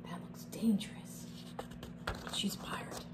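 hushed whispered remark; whispering. Real, not acted. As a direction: whisper it like a secret. Whispered comments on the pictures.

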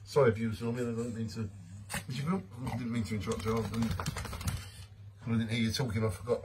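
quiet sheepish apology to the viewers; hushed apology. Real, not acted. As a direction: drop the voice so you don't interrupt. Apologising for interrupting someone.

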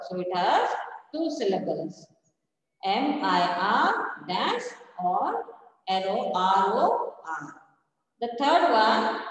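ordinary talk

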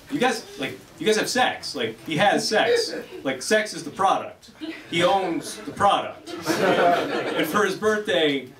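slow, spelled-out mock lecture, laughing through it; mock lecturing. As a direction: lay it out slowly like explaining to a child. Retold rant at the friend.

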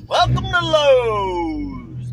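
high, loud, long-drawn store welcome; calling out greeting. Pretend. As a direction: holler it slowly, stretching the store name out.

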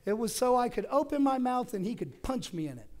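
pushing the point hard; high and loud outrage; heated emphasis. Real, not acted. Retelling the angry bidder.

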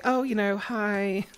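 high-pitched customer-service greeting; retail politeness. Acted. Acting out polite retail chat.

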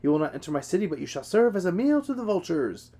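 high, loud gloating warrior's boast; triumphant. A narrator's reading. Hector gloating down at dying Patroclus, high and triumphant. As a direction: crow over the fallen enemy. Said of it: "Hector exclaimed triumphant".

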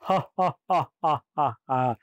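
booming ha-has stepping down in pitch; theatrical villain guffaw; demon laugh. A narrator's reading. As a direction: laugh menacingly in slow beats.

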